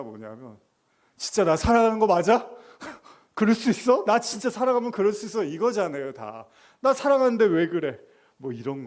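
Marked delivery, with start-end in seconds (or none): 1.2-5.4 high, loud pouty lover's complaint; sulky lover. Acted. Lover's quarrel voiced. 6.8-8.0 high, loud pouty lover's complaint; sulky lover. Acted. Lover's quarrel voiced.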